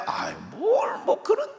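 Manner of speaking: very high, whiny, dismissive; Abraham sulking that he has no child; petulant Abraham. Acted. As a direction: pout and brush God off. Abraham mocked as sulky.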